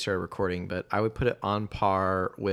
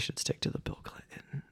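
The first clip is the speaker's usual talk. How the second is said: whisper; 11 dB quieter than usual. Real, not acted. Teasing aside to co-host.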